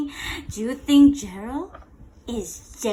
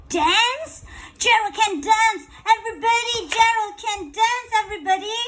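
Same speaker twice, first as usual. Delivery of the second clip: higher, louder calls to everyone; excited shout; 8 semitones higher, 4 dB louder than usual. Acted. Teacher voicing animals announcing Gerald's dancing. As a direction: spread the amazing news. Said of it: "They shouted".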